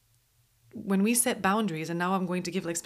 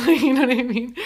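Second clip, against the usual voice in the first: louder, laughing through the question; 5 semitones higher, 11 dB louder than usual. Real, not acted. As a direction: laugh it out while asking. Laughing at own story.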